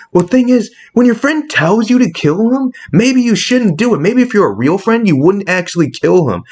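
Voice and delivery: high-pitched, heated protest; indignant rant. Real, not acted. Fan objecting to a friend killing Hulk, indignant. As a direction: argue it in high, exasperated disbelief.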